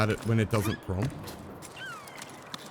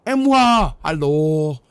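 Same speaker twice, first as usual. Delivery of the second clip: excited greeting; 3 semitones higher, 5 dB louder, slower than usual. Real, not acted. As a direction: call out a bright welcome to a fan. Streamer greets a new subscriber.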